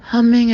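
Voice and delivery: a short hummed tune; humming. Acted. Lexi humming as she heads to the DVD. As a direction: hum a few notes to yourself. Narrator hums before the scene.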